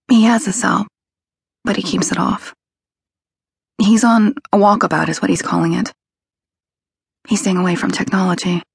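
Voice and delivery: lightly voiced anxious girlfriend; anxious young woman voice. A narrator's reading. Kelly voiced.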